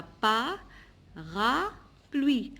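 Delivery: "pas, ra, pluie" as three separate beats; syllable by syllable. Shown as a demonstration. Teacher listing three syllables for pupils, syllable by syllable. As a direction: pause after each part of "pas, ra, pluie". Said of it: "Trois syllabes".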